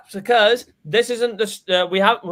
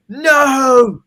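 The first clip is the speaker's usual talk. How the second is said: long, loud, high mock wail; hammed-up dramatic shout of dismay; movie-style despairing cry; 7 semitones higher, 7 dB louder than usual. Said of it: "you do the thing in a movie".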